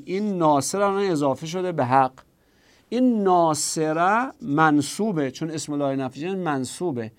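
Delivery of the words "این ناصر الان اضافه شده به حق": normal talking voice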